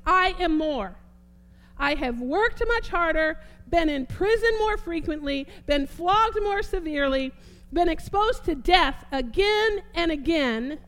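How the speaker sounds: strong, declamatory Bible reading; rolling, emphatic pulpit delivery; preaching scripture reading. Real, not acted. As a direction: proclaim each item of the list, leaning hard on key words. Reads scripture aloud in preaching voice.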